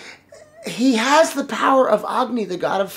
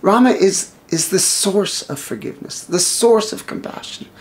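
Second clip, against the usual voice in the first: usual speaking voice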